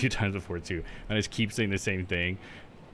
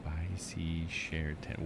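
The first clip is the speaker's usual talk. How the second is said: soft, slow, lower-pitched repeat; quietly sounding out; 5 semitones lower, 9 dB quieter, much slower than usual. Shown as a demonstration. Streamer puzzling over chat's phrase, quietly sounding out. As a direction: say each word slowly to yourself.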